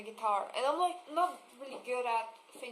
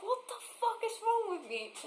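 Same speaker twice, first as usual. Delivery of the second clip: exasperated; 10 semitones higher than usual. Retold or imitated. Frustrated at wrong string.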